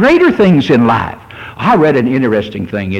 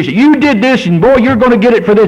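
accusing Satan voice; 7 semitones higher than usual. Retold or imitated. Preacher voicing Satan's threat to the guilty, mock harsh. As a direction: hammer the guilt like a bully. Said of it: "That's Satan when you hear".

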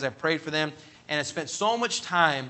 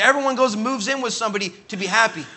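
raised pitch on a retold outcry; impassioned realization; 6 semitones higher, 5 dB louder, faster than usual. Young man confessing to his pastor, impassioned. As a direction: exclaim it like a sudden discovery.

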